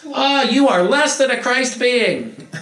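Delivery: high, loud, hammed-up outrage; mock scandalized scold. Scolding someone without a mask, in high loud mock horror. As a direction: squeal it like a busybody scolding.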